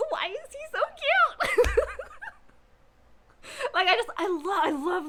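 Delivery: high-pitched gush, laughing at the end. Retold or imitated. Fan gushing over the sweet hero, high and giddy. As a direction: squeal over how cute he is. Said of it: "And I'm just like".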